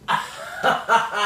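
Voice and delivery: high-pitched burst of laughter; amused laugh. Real, not acted. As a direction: crack up in a high laugh at the dog. Amused by the dog's antics.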